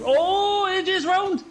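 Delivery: sudden high yelp of shock; excited shout. Real, not acted. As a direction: blurt it out loud and high. AJ spins out during the race.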